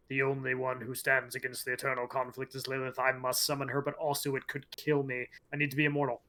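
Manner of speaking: low, soft, level voice of someone reading notes; reading notes aloud. Retold or imitated. Retelling Elias reading Rathma's notes, low reading voice. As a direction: murmur the notes as if to yourself. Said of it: "he's reading Rathma's notes".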